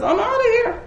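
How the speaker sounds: high, laughing "I'm outta here"; mock breezy exit line; cocky quip. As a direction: toss it off breezily.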